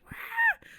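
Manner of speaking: sudden piercing shriek, way up high; startled high-pitched scream; high shriek "wah". Retold or imitated. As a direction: yelp like wood just hit your face. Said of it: "I shrieked".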